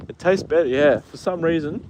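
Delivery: usual speaking voice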